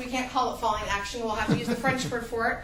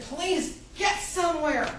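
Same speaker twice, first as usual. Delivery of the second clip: drawn-out, higher-pitched begging; exasperated plea; 4 semitones higher, slower than usual. Acted. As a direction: plead slowly with a book that drags. Reader pleading with a slow book.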